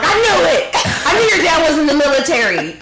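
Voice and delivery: high, loud laughing gotcha; mock-outraged high voice; triumphant accuser. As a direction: crow it like an online accuser. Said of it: "this is gonna come back out".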